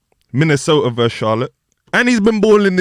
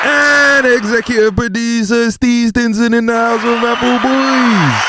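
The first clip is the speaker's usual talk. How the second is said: hype announcer; 7 semitones higher than usual. Acted. Hype-man introduction of the producer.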